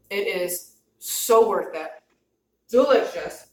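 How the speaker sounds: talking through a mouthful; words round a bite of food; mouth full. Real, not acted. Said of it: "Talked with my mouth full".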